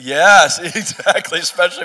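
bursts out laughing, loud and high. Real, not acted. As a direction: laugh it out in agreement. Amused agreement with host's remark.

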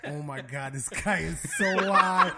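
laughing it out; cracking up mid-sentence. Retold or imitated. Laughing at stoned friend.

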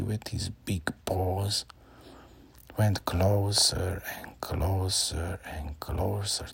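slow, hushed creeping narration; suspense whisper. A narrator's reading. Bear creeps toward boy.

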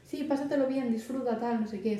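lightly voiced teen turning down a drink kindly; breezy brush-off. Shown as a demonstration. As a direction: cheerfully wave off the drink and push it back. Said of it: "Tú, pero".

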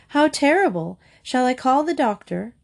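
high, dismayed storybook wail; mild wailing alarm. A narrator's reading. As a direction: exclaim in horrified concern. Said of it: "wailed Missus Semicolon".

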